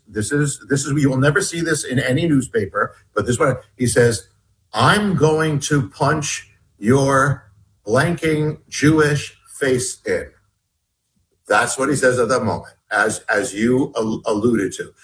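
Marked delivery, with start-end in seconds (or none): 4.7-10.3 slow, spaced-out retold threat; deliberate, drawn-out quote. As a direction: quote the threat word by word, very slowly. Retelling the attacker's spoken threat.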